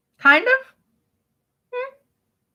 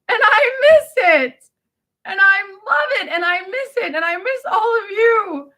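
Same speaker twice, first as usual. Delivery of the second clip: higher voice, on the edge of crying; tearful longing; 7 semitones higher than usual. Real, not acted. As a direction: let real tears lift the voice. Tearfully misses old restaurant friends.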